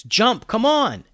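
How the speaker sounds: high, loud, drawn-out shout; yelling. A narrator's reading. Alex tells friends to jump aboard, yelling. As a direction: urge them to leap with a slow, stretched yell. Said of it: "yelled Alex".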